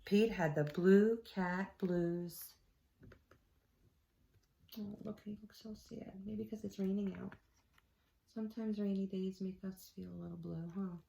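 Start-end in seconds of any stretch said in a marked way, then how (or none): 4.8-11.0 soft, hushed aside to the little listeners; quiet aside to kids. Acted. Reader turning to the kids, hushed aside. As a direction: murmur it to the children beside you.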